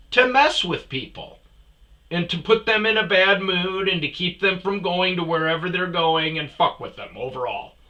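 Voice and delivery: loud, high-pitched demand for an answer; angry interrogation. Retold or imitated. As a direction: grill him hard, raising your voice. Retold interrogation of other driver.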